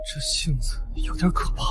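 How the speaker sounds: half-whispered, airy remark; hushed aside. Acted. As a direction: murmur it privately, barely voiced. Aside about her temper.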